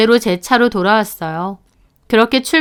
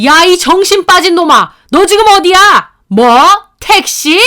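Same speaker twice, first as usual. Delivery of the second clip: much higher, louder yelling down the phone; furious yelling; 9 semitones higher, 7 dB louder than usual. Acted. Father-in-law yells at his son on the phone, furious. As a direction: bellow at your son. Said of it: "씩씩대며 전화를 거셨어요".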